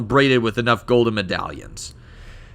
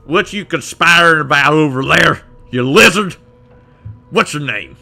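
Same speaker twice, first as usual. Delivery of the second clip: louder, gruffly challenging character voice; put-on voice demanding answers from a stranger; game character's voice; 5 semitones higher, 8 dB louder than usual. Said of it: "what voice I gave this guy".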